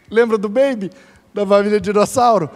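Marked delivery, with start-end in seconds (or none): none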